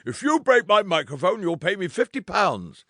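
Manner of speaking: high, booming schoolmaster telling off the crowd; schoolmaster bellow. Retold or imitated. John bellowing at screaming fans, schoolmaster fury. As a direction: bark it out like an angry schoolmaster. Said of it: "bellow at them like a schoolmaster".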